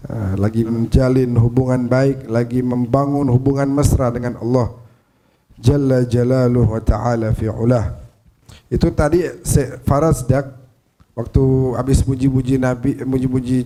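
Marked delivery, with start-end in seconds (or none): none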